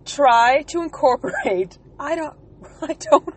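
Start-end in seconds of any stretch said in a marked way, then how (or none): 2.0-3.3 stifled giggles. Real, not acted. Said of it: "I'm trying not to laugh".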